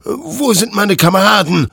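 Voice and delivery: loud, high, agitated threat; threatening. A narrator's reading. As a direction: threaten the creature in desperate defiance. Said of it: "drohte Hektor".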